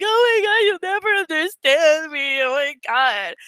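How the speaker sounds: high whiny lament, fading down; mock despair that sinks at the end; dramatic lament to a partner. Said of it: "or you're like".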